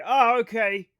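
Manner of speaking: loud, dismissive mock acceptance; mock reaction. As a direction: put on a loud "oh, okay". Acting out own dismissive reaction.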